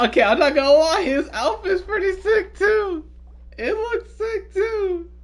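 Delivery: high, loud, laughing praise; excited. Real, not acted. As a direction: gush over it, thrilled. Gushing over the outfit.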